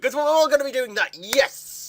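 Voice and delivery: loud, high mock-excited cheer; hammed-up enthusiasm. Vlogger on sharing favorites on Facebook, hammed-up cheering.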